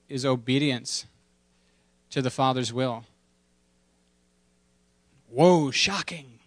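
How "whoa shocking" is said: slow, sarcastic whoa; mock shock. Preacher teasing the congregation, slow mock amazement. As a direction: feign being amazed, drawn out.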